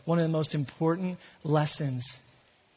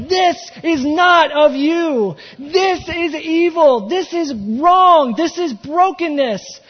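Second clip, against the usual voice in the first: slow, loud, high cry; passionate shout; 7 semitones higher, 7 dB louder, slower than usual. Acted. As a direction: shout it out in anguish. Peter's passionate outcry voiced.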